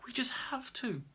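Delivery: whispered plea. Acted. Boy pleading softly.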